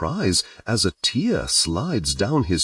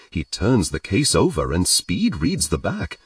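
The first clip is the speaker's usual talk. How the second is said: ordinary delivery, nothing marked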